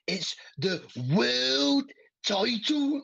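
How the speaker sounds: high, loud, drawn-out Liverpudlian mimicry; Liverpudlian accent. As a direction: stretch it out in a hammed-up Liverpudlian accent. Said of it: "he started doing this Liverpudlian accent".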